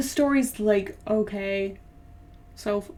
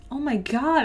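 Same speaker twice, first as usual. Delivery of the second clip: usual speaking voice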